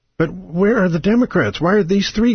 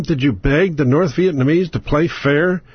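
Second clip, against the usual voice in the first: normal talking voice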